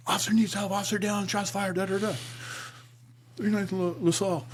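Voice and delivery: high-pitched urgent radio voice; radio distress call. Retold or imitated. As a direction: imitate an officer calling in shots fired. Imitates officer's radio help call.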